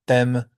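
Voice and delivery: t'aime with the T going straight into the vowel; French unaspirated T. Shown as a demonstration. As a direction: say t'aime with no puff after the T. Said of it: "that T goes straight into the vowel".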